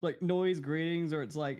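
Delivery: ordinary talk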